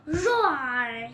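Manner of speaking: loud playful roar; kid's pretend dinosaur roar. Dinosaur toy attacks another.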